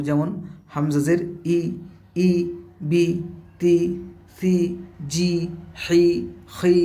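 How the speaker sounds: each syllable ends on a short i, like বি, তি; zer syllable drill. Shown as a demonstration. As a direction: recite the i syllables one at a time. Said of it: "জের থাকলে আমরা জেরের মতো উচ্চারণ করব".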